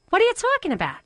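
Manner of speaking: high Brooklyn mom, baffled; mother's Brooklyn accent. Retold or imitated. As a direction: question it like a puzzled Brooklyn mother. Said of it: "She's got a Brooklyn accent".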